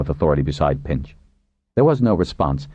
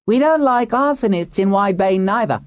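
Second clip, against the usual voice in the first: high-pitched call over a crowd; woman shouting. A narrator's reading. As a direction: shout it to be sure you're heard. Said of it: "a woman shouted".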